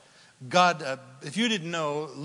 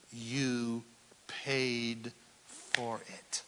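soft reverent; 5 semitones lower, 7 dB quieter, much slower than usual. Acted. Soft slow line about the cross.

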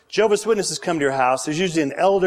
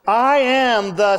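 call and response; 6 semitones higher, 3 dB louder, slower than usual. Shown as a demonstration. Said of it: "say it with me".

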